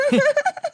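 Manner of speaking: shrill honking laugh squeal; high squealing laugh. Real, not acted. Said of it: "a fucking goose loose in here".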